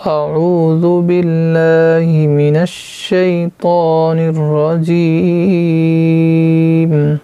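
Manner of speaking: Quranic recitation. Shown as a demonstration. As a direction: draw out each word in a chanted recitation. (تلاوت کے انداز میں، آہستہ اور بلند آواز)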